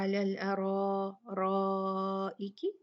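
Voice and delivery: very slow drawn-out recitation. Shown as a demonstration. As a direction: stretch each vowel melodically.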